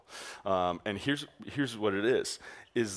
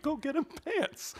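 much higher, slow disbelieving command; exasperated order; slower than usual. Retold or imitated. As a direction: spell it out in disbelief. Co-leader forgot pants.

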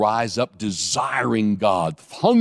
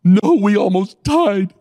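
retold repeat of the line; 9 semitones higher, 5 dB louder, slower than usual. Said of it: "I said".

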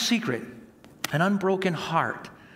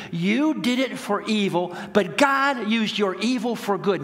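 rising, louder preached voice of Joseph forgiving; preaching at full force; 5 semitones higher, 3 dB louder than usual. Acted. Preacher voicing Joseph forgiving his brothers, loud and rising. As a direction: preach it, building to a loud cry.

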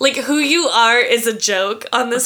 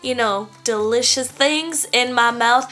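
sugary Southern belle tourist; put-on Southern twang, sweet-talking a stranger; Southern woman accent. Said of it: "My accent is going to be a Southern woman".

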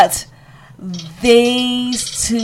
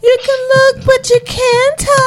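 Maryse. Retold or imitated. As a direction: imitate her in a much higher voice. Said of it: "And then she was like".

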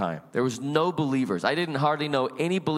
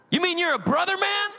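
much higher, eager voice, delighted to find a fellow believer; overjoyed, high-pitched greeting; excited stranger. Retold or imitated. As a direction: bounce up in pitch, thrilled.

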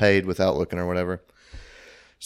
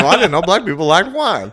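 retold line blurted out loud and higher; a mock-innocent outburst; loud quoted blurt; 9 semitones higher, 8 dB louder than usual. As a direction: blurt it out, much louder and higher. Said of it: "I was like".

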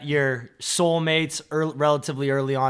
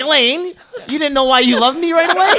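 mock hurt husband; 10 semitones higher, 5 dB louder than usual. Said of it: "He's gonna be like".

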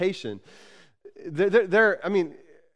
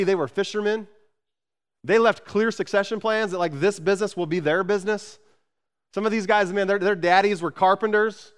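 raised, louder, driving sermon voice; preaching at full force; 5 semitones higher, 5 dB louder than usual. Acted. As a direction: press every line home to the congregation. Preacher builds intensity.

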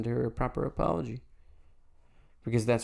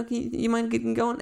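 polite nudge; 13 semitones higher than usual. Retold or imitated. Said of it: "a nice little honk".

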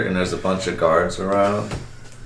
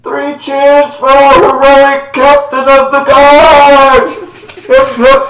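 booming, much higher trumpeting cheer; mammoth voice; 17 dB louder, much slower than usual. Acted. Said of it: "you kinda sound like a mammoth".

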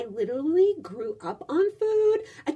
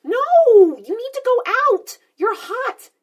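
shouting; 6 semitones higher, 6 dB louder than usual. Real, not acted. Friend protests loudly.

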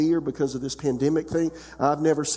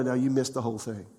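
ordinary talk

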